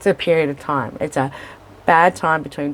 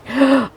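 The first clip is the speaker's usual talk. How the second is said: a sudden, high tearful gasp; mock gasp before tears; sharp gasp; 9 semitones higher than usual. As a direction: catch your breath as if about to cry. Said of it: "I just burst into tears and I think".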